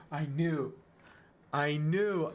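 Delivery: pressing the point home; insistent. Real, not acted. Streamer to chat about the missed win, emphatic.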